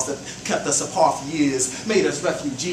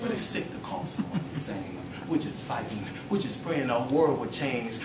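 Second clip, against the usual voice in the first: measured, soft spoken-word delivery; poem recital; 2 semitones lower, 6 dB quieter than usual. Acted. Poet reciting to the audience, in a hushed spoken-word voice. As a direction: let each line land like verse.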